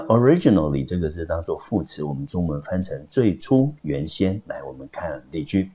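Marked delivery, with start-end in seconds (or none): none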